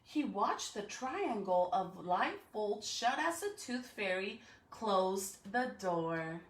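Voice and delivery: plain reading voice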